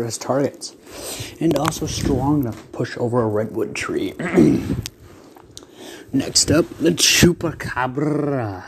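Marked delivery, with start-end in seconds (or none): 6.1-8.6 dramatic announcer. Acted. Announcing second fighter.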